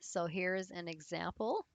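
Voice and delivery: ordinary delivery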